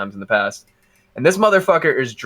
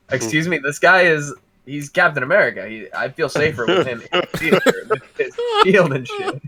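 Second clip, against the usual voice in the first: half-laughing, pitched higher, a cheeky plea to the guard; laughing mock plea; 5 semitones higher than usual. Friend argues with the security guard, laughing and pitched higher. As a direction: giggle through a mock-earnest defence of Captain America. Retelling Kenji's cheeky argument.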